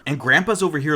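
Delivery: ordinary talk, nothing marked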